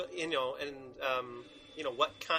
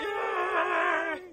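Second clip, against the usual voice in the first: high cheer; 6 dB louder than usual. Pretend. Cheering the Kermit bit.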